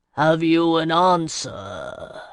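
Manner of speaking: slow, grave voice of an ageless sea lord; ancient sea lord. Acted. Sea lord questioning the gathered mortals, slow and grave. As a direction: let each word roll out like the tide. Master of the Straits speaks.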